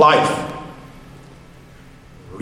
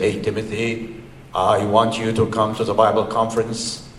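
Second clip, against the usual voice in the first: low, hushed voice in the ear; mock whisper; 7 semitones lower, 7 dB quieter than usual. Preacher imitating the Lord speaking privately to Timothy, mock whisper. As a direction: quietly imitate a confidential whisper. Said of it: "gonna whisper in his ear like that".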